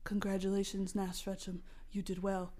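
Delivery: low, hushed inner voice; mysterious voice. Acted. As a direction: speak softly and low, like an unseen guide. The voice congratulates the hero.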